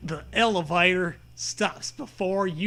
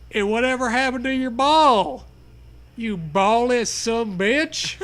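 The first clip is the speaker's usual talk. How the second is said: mocking; 4 semitones higher, 5 dB louder than usual. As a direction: sneer the insult. Mocking insult voice.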